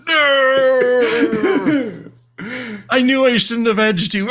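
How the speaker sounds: high, loud cartoon wail; hammed-up anguished cry. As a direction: wail it like a doomed villain. Acting out Queer Mantis being blasted.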